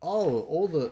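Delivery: high, sarcastic put-on voice; mock the studio's fake confusion; mocking whine. Parodying the studio after the flop, high sarcastic whine. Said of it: "and it's like".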